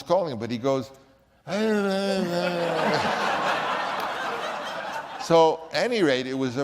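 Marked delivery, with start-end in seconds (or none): 1.5-5.2 high delighted laugh; big laugh. Real, not acted. Telling of the phone ringing mid-take, delighted laugh. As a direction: burst out laughing at the memory.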